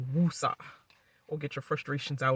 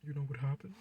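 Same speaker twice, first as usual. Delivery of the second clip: mock Marty; 2 semitones lower, 11 dB quieter than usual. Imitating Marty's line once more.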